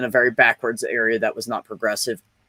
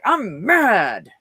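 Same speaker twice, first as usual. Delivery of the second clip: put-on higher, pouting little child; sulky kid's "I'm mad"; 8 semitones higher than usual. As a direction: grumble it like a cross five-year-old. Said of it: "I used to go".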